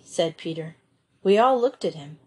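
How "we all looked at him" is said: usual speaking voice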